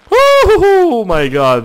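high, drawn-out shocked cry; a high, slow yelp of surprise; startled shout. Real, not acted. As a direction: gasp it out in real shock. Sudden elf appears in game.

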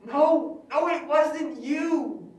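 high, loud, slow pleading no; desperate denial. Acted. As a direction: cry out to stop her blaming herself. Ghost desperate to reassure her.

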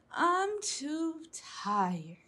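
slow sleepy little frog. A narrator's reading. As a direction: drag it out, half asleep. Froggy gives up, sleepy.

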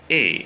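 y as a sustained "ee", lips spread sideways; long smiling i. Shown as a demonstration. Teacher repeats the model vowel for learners, long smiling i on y. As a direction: smile wide and hold y as "ee". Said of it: "cười sang hai bên".

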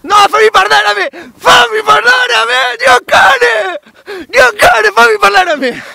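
high furious shout. Real, not acted. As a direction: yell to be let speak. Demanding to speak, shouting.

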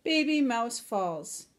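usual speaking voice

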